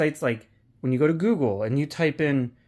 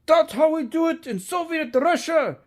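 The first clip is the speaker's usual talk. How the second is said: Russian accent impression; 12 semitones higher than usual. Vlogger retelling Armageddon, doing the Russian guy shoving her aside, in a hammed-up Russian accent. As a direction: bark it like a brash Russian cosmonaut in a mock Russian accent. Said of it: "He goes".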